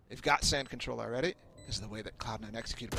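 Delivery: ordinary delivery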